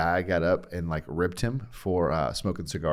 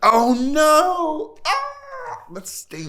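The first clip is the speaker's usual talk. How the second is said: sudden high cry of dismay, much higher and louder; a real pang of disappointment; dismayed surprise; 8 dB louder, much slower than usual. Real, not acted. Hearing a favourite preacher is dead, real dismayed cry. Hears Tim Keller is dead.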